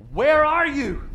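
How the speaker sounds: loud, high, drawn-out call. Acted. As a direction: call out as God searching for the man. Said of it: "He calls out and says to the man".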